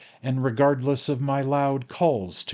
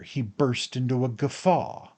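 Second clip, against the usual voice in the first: plain reading voice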